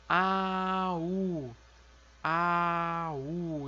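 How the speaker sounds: A, U, A, U, each vowel in its own beat; modelled syllables. Shown as a demonstration. Teacher modelling little brother in Korean. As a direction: spell the word out vowel by vowel. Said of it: "Então".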